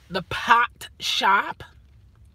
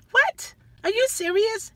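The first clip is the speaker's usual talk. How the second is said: sharp high rise of disbelief; mock disbelief; 10 semitones higher than usual. Uber driver scoffs at a passenger's request, high incredulous pitch.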